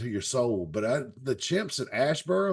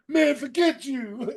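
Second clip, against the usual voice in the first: imitated near-tears retort; 12 semitones higher, slower than usual. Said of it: "He was about to cry".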